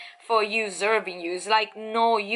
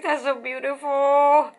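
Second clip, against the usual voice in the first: slow, louder gush of delight; 3 semitones higher, 5 dB louder than usual. Real, not acted. Reader admiring the Knight of Cups card, slow delighted gush. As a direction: savour every word, thrilled by the card.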